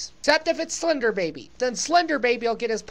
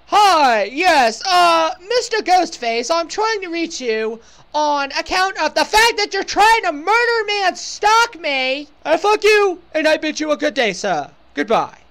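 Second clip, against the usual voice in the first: loud, pompous mock British caller; indignant British gent, hammed up; put-on British accent; 3 semitones higher, 5 dB louder than usual. Leaving a phone message for Ghostface, mock British accent. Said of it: "I decided to become British".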